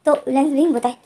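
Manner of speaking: usual speaking voice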